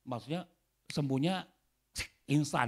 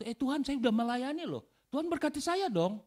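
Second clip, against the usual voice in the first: mock demanding believer; 11 semitones higher, 4 dB louder than usual. Mocking a demanding believer, then rebuke.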